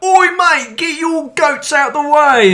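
very high, loud, drawn-out British yell; hammed-up "oi, mate" Brit shout; rowdy British bloke. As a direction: holler like a mock British lout. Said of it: "British people are slightly more like".

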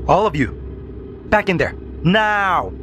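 high, commanding shout, last word stretched; teacher yelling. A narrator's reading. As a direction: order the class back sharply. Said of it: "Mister Harrington yelled".

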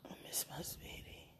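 breathy late-night whisper to a loved one; soft whisper. Real, not acted. Whispering goodnight to her sweetheart on the phone, hushed. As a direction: whisper it close to the phone, tender.

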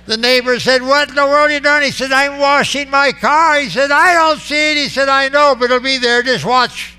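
loud, high and excited storytelling; animated retelling. Retold or imitated. Preacher acting out a neighbor questioning the car washer, high and loud.

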